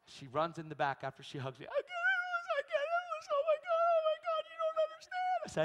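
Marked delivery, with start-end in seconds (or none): 1.7-5.4 imitated crying. Imitating the waitress crying in thanks. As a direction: sob it out in a high voice. Waitress overwhelmed after blessing.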